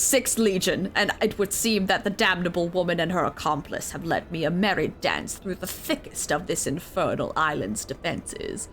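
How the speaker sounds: a hint of a cold Garlean officer; lightly voiced commander, grumbling about being led astray; stern imperial commander. Officer reporting his chase of the woman, lightly stern. Reading the legatus's lines.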